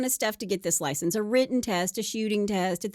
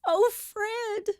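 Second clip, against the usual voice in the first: high, hammed-up wailing; mock sobbing cry, pitched way up; crying and shouting; 11 semitones higher, much slower than usual. Wife calling from inside the closet, mock crying. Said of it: "they can hear Dolly crying and shouting".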